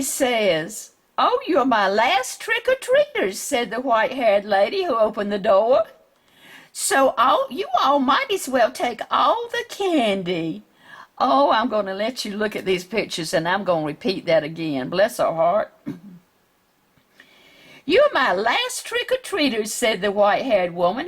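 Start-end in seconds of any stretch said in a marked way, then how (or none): 1.2-3.4 put-on high sweet old lady voice. 6.7-10.6 put-on sweet old lady. 17.8-19.7 put-on sweet old lady.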